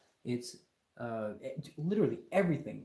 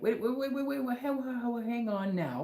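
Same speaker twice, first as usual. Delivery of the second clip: much higher, frantic "wait wait wait"; urgent interruption; 10 semitones higher than usual. Retold or imitated. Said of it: "we should have stopped and been like".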